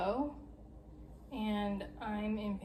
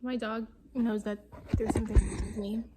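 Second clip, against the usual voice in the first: ordinary delivery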